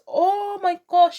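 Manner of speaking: high, drawn-out awe; slow gushing "oh my gosh"; gushing admiration. Real, not acted. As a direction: stretch it out high and slow, swooning. Gushing over the stunning necklace.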